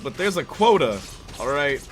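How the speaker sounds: mock Brooklyn wise guy, explaining; put-on Brooklyn accent. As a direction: lay out your case in a Brooklyn accent. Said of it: "The accent slipping".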